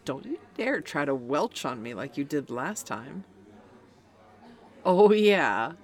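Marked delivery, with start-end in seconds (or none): 4.8-5.7 loud laughing oh yeah; laughing gloat. Acted. Reminding her friend of the lost bet, laughing loudly. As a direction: laugh it out, relishing it. Gloating about last time.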